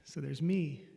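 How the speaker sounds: normal talking voice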